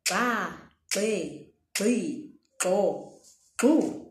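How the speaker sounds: xa, gxe, gxi, gxo, gxu each said with a crisp click; click syllables recap. Shown as a demonstration. Teacher recapping the click syllables for learners. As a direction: sound out the gx syllables one by one with the click. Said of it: "here's how you pronounce it".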